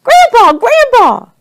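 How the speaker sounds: high, loud frightened cry; children's panicked shout; screamed in alarm. A narrator's reading. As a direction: scream out for him, falling on each cry. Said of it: "We all screamed".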